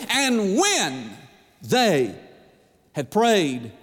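when, they, prayed each set apart by pauses; spaced-out preacher emphasis; halting build. Shown as a demonstration. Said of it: "repeated for emphasis".

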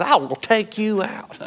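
hammed-up movie hero growl; Clint Eastwood. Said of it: "like Clint Eastwood".

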